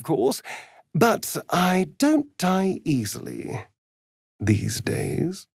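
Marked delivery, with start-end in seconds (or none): 4.4-5.5 menacing whisper. Acted. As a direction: let it fade into a sly whisper.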